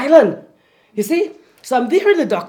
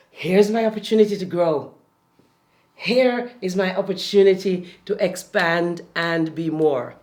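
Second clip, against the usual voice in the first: lower, measured reassurance; calm acceptance; 4 semitones lower, 4 dB quieter than usual. Acted. Coach modelling a response to challenge, calm and assured. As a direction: settle into composed self-talk. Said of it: "It's like".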